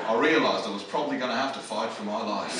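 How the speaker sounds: low, mock-solemn survivor's realisation; serious. Shark survivor confides his realisation to camera, serious. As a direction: deliver it gravely as the Australian, pitched well down. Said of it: "he just kinda went serious".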